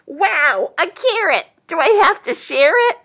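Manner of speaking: high, loud delighted doe; young doe. A narrator's reading. Doe finds carrot treat, high and excited. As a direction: exclaim eagerly as a young deer. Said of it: "said the young doe".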